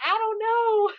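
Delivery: high, laughing helplessness; laughing fluster. Retold or imitated. Dodging viewers' questions, laughing up high. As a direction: squeak it out through a laugh. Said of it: "I'm like".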